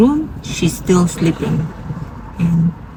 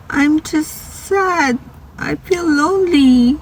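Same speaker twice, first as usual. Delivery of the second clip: high, plaintive retelling of a sad patient; tearful sadness; 9 semitones higher than usual. Retold or imitated. Patient admits to her sitter she feels lonely, retold sad and high. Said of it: "crying. She's hiding it".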